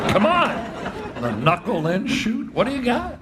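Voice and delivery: half-laughing come on, what have you got; playful incredulous ribbing of the pitcher; incredulous teasing. Retold or imitated. Coach mocking pitcher's strange pitch.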